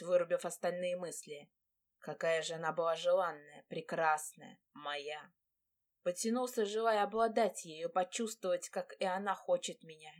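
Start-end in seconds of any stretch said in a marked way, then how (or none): none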